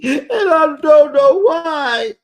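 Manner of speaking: high, drawn-out mock wail; mock crying. Recapping Lisa's outburst to viewers, mock crying. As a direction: imitate a loud tearful whine. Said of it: "Why is Lisa crying?".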